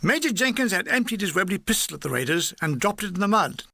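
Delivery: ordinary delivery, nothing marked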